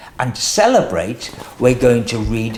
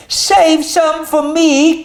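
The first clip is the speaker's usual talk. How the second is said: much higher, louder and slow plea; crying out; 13 semitones higher, 5 dB louder, slower than usual. Acted. Douglas calling to the sheep for cake, high slow plea. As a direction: call out desperately not to be left out. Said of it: "cried Douglas".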